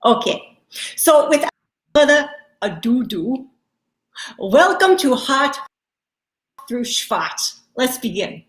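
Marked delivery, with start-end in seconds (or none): none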